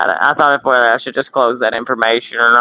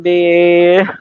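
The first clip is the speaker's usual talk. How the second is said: ordinary talk, nothing marked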